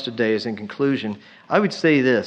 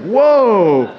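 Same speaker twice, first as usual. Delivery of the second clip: louder, higher laughing whoa; amazed whoa; 6 semitones higher, 8 dB louder than usual. Retold or imitated. Kid hearing about the enema, amazed laughing whoa. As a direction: react like a wide-eyed kid. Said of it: "I was like".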